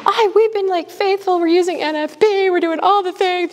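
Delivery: high-pitched, exasperated protest; frustrated complaint. Retold or imitated. Woman protesting to the priest in confession, high and exasperated. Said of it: "had the whole thing with the priest".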